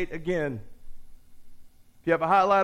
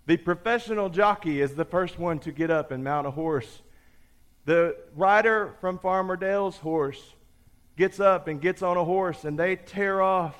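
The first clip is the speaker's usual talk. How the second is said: ordinary talk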